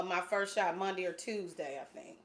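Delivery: ordinary delivery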